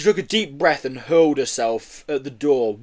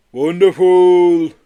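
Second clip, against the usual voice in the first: loud, very slow, drawn-out delight; blissful tipsy yeti; 5 semitones higher, 10 dB louder, much slower than usual. A narrator's reading. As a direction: revel in it, slurred and high.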